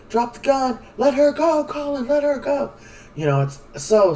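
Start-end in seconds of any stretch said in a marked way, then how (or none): 0.1-2.7 high, loud pleading yell; high-pitched yelled command; retold yelling. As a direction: shout it at him to let her go. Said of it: "she's yelling at him".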